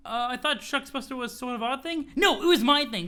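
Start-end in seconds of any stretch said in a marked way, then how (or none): 2.2-3.1 possessive snap. Acted. Jane insisting angrily.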